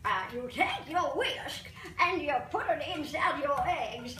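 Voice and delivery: put-on slow, drawn-out old granny voice; old lady voice. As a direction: talk like a fussy old lady showing how to whisk. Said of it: "That's my old lady voice".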